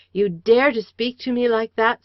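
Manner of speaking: loud, high outraged cry; indignant cry. A narrator's reading. Daughter confronting her father's killer, loud outrage. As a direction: hurl it at him. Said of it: "I cried".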